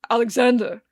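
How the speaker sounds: calling out loud. Acted. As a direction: bellow "Alexander" at him. Drunk man calls out insistently.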